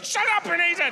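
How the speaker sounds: high, loud mock rage at the baker; shouted outburst. Comic telling off baking show contestants, yelling. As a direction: snap at them to shut up.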